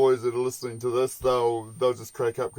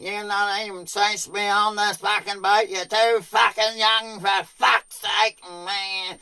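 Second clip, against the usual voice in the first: higher, gruff scolding voice, slow; imitating angry skipper; 7 semitones higher, slower than usual. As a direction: tell the kid off, mock angry. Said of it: "he's like".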